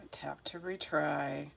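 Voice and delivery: under-breath whisper of the screen text; whispered reading. Real, not acted. Streamer reads her frozen screen, whispering. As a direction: read the prompt to yourself in a whisper.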